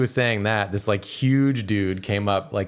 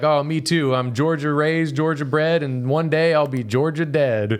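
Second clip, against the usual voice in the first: put-on louder, higher good-ol'-boy Southern voice; mock a big friendly Southern man; huge Georgia man; 8 semitones higher, 4 dB louder than usual. Imitating the big Georgia man welcoming a New Yorker. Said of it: "the huge Georgia man saying that".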